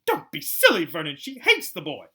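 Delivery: high, loud voice of a scornful aunt. A narrator's reading. Aunt scoffing at her husband, high and loud. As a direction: brush off the husband's silly idea, high and loud. Petunia dismissing Vernon's suggestion.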